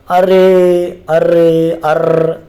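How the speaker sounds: "अरे, अरे, अर्र" called out loud with the final "र्र" rolled and held long; stretched pluta vowel. Shown as a demonstration. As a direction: hold the vowel past a long one, as if calling out to someone. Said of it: "दीर्घ स्वर से भी ज़्यादा समय लगता है इसके उच्चारण में".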